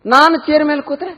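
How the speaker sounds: high put-on interrogating voice; pressing mother-in-law. Mother presses the new bride further, high testing voice. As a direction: challenge her with a high-pitched question.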